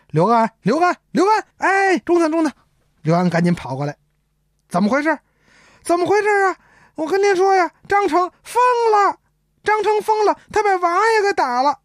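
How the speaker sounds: high, urgent cries; alarmed calling. Acted. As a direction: call out breathless with staged alarm. Servant cries out in staged alarm. (假装惊慌地高声呼喊)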